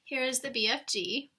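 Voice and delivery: plain reading voice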